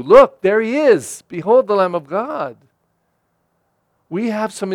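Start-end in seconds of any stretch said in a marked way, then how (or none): 0.0-2.6 louder, announcing "Look"; proclaiming call. Retold or imitated. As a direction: point him out to the crowd with a call. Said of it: "We have some pointing to do".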